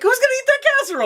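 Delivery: very high, rapid mock disbelief; high-pitched comic bafflement; incredulous outcry. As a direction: squeal the question in a rush. Retells own incredulous reaction.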